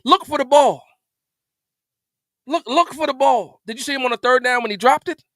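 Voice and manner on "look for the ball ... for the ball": imitating the quarterback. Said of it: "He said".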